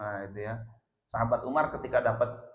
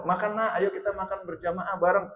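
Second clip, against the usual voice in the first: lightly voiced parent, pitched higher; parent calling child; 7 semitones higher, 3 dB louder than usual. Parent calls child to a family meal, coaxing call. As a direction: call the child to come and eat. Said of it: "anak dipanggil".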